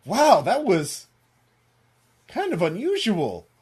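high, slow, taken-aback reaction; politely baffled, with a pause midway; Maria. Acted. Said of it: "Maria is like".

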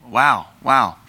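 normal talking voice

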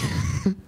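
squealing giggle way up high; high squeal of laughter. Real, not acted. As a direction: laugh breaking character. Breaking character laughing.

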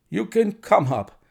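jangled nerves. A narrator's reading. Nervous patient letting the visitors upstairs, jangled nerves. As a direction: call them up, pitched high and tense. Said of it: "testified to his jangled nerves".

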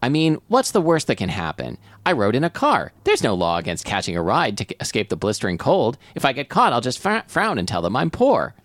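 animated reading, rising to a high-pitched finish; story narration. Acted. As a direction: talk yourself into it as the comic narrator. Reads story aloud.

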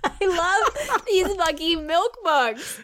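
laughing through the words; slow, cracking up. Real, not acted. Reading line while laughing.

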